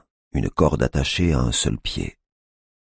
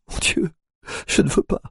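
whispered despair. A narrator's reading. Collapsed mother in his arms.

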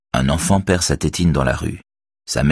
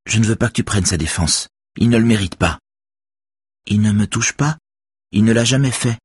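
put-on higher girl's voice, earnest and firm; teen girl voice; 6 semitones higher than usual. A narrator's reading. Girl defends Axel to Robert, higher earnest voice. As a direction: voice the young girl defending him. Beverly's voice.

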